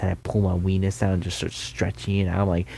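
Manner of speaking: normal talking voice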